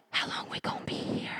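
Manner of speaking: unvoiced, quick whisper. Kids whispering to each other during prayer, hushed. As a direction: whisper so grandma won't hear. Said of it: "looking at each other like".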